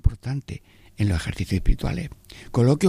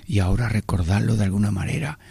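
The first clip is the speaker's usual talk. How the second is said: ordinary talk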